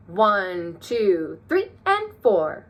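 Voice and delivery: plain one, two, then three, and four jumping high. Shown as a demonstration. Teacher showing how to highlight eighth notes with a high voice. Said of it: "I counted the eighth notes higher".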